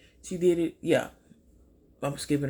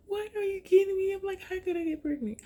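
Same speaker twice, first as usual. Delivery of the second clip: high, squealing what and how; shocked disbelief. Retold or imitated. Patient reacting to the nurse's pregnancy news, shrill shock.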